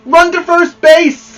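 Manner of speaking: loud, high and drawn out; yelling. Acted. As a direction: call the runner, slowly and loud. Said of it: "yelled the boys".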